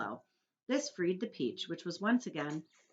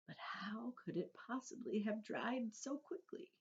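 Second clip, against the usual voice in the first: put-on old grasshopper; 4 semitones higher, 11 dB quieter than usual. A narrator's reading. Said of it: "he asked".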